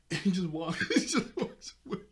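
high, loud helpless laughter through the words; real laugh breaking up the sentence; laughing while talking. Real, not acted. Narrator cracking up at Zuko just walking away, laughing. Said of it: "It's just how funny".

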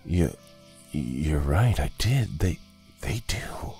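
hesitant, acted admission ending hushed; dawning clarity. A narrator's reading. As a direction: agree haltingly as it sinks in. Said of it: "Jonah said with clarity".

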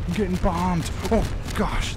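alarmed. Real, not acted. Getting bombed in game.

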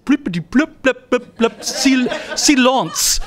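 high, rapid nonsense blips of a foreign voice; mock French gibberish. Storyteller mimics a French student's complaint at the door, high mock babble. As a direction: parody how an annoyed French student sounded to you. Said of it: "she's saying something that I heard as".

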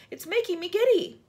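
high, loud complaint; calling out. A narrator's reading. Miss Spider giddy high on the peach, calling out. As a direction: call down in distress. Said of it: "Miss Spider called out".

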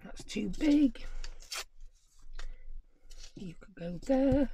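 soft whisper, slightly slow; whispered aside. Real, not acted. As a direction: breathe it to yourself. Crafter muttering to herself.

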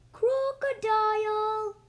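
high, drawn-out call of "Crocodile!"; calling loudly. Acted. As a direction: call Crocodile across the river. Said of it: "He said loudly".

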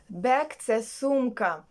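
ordinary talk

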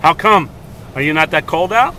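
ordinary talk

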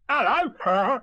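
high, loud Tigger hello; calling out. A narrator's reading. Tigger surprises his hidden friends, loud call. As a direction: holler a high greeting.

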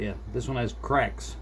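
usual speaking voice

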